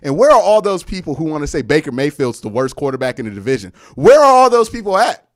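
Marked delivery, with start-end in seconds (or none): 4.0-5.3 loud, high taunting question; taunting call-out. Real, not acted. Fan taunting rival fans on stream, loud and high. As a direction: call out the doubters, louder. Taunting the doubters after a win.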